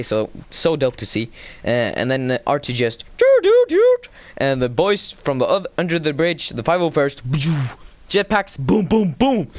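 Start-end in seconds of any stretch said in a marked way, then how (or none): 3.2-4.0 three high, loud astromech beeps; imitated droid beeps. Imitates R2's beeping. 8.6-9.5 high-pitched booming sound effects; imitated explosions. Imitates explosions in excited retelling.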